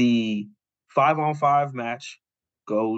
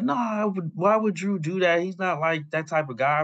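high-pitched retold objection; imitating a doubter, voice lifted high; incredulous protest; 7 semitones higher than usual. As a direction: push back in disbelief, pitched well up. Acting Paul disputing the accusation.

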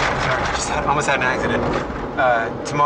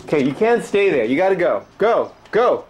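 urging; 5 semitones higher, 4 dB louder than usual. Real, not acted. Urging pigeon to leave.

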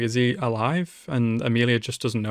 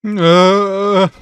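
pained groan; 7 semitones higher, 11 dB louder than usual. Real, not acted. Emotional anime scene.

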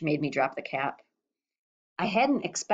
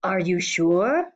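husky; 3 dB louder, slower than usual. A narrator's reading. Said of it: "His voice came out huskier than ever".

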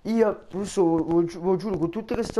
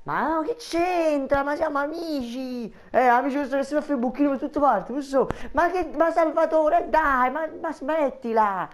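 high protesting voice; 7 semitones higher than usual. Acted. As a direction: play it coy and offended, up high. Russo protests playfully, falsetto voice.

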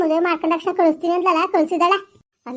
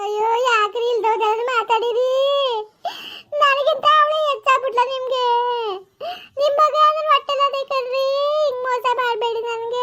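much higher, slow tearful wife's sobbing plea; crying young wife; 8 semitones higher than usual. Acted. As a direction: beg your husband through tears not to cheat you. Wife cries at husband.

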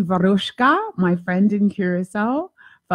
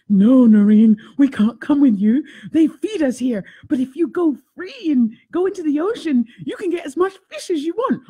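higher playful sea lion reply; pretend sea lion voice; 7 semitones higher, 4 dB louder than usual. Sea lion refusing the host, higher playful voice. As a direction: answer as a cheeky, well-fed sea lion. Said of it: "I was making up this whole story".

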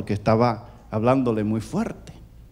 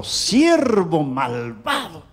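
slow, drawn-out stern rebuke; mild indignation, stretching each word out; displeased master; much slower than usual. Acted. Displeased lord rebukes his wicked servant, stern and slow. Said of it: "el Señor no le gustó mucho eso".

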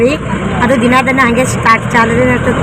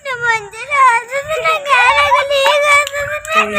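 high, drawn-out tearful wail; real crying; 13 semitones higher, 3 dB quieter, slower than usual. Real, not acted. Child left at home as parents head out, crying. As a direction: wail the words slowly through real tears. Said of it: "ಅಳಬೇಡ".

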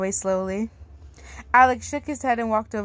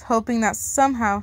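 ordinary delivery

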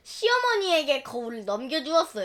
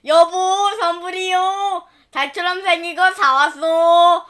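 louder cheerful calling out; proud call to wife; 4 semitones higher, 7 dB louder than usual. Acted. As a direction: call her over, pleased with the gift. Farmer calling to his wife.

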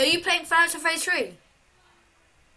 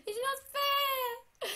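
soft, slow, higher whimper; 8 semitones higher, 9 dB quieter, much slower than usual. Real, not acted. Player losing the game, soft tearful whine. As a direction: whine it close to tears.

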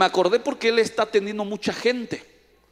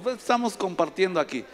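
ordinary talk

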